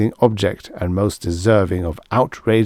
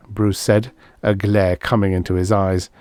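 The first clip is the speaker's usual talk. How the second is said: plain reading voice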